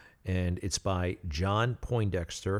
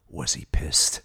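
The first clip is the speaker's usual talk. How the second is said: conspiratorial whisper, lower; 5 semitones lower, slower than usual. Retold or imitated. North asking [name] about the angry boss, whispered. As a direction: hush it like a secret. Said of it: "North turns to me and goes".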